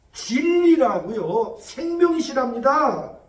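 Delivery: slow, loud preaching; preaching emphasis. Real, not acted. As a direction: stretch each word to drive it home. Preacher proclaiming to congregation.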